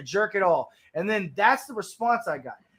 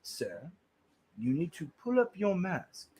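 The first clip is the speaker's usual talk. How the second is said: low, quiet mock of a man giving orders; a lightly voiced snooty stranger; mocking stiff voice; 3 semitones lower, 8 dB quieter than usual. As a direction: drone it like a prissy rule-enforcer. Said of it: "And he'd be like".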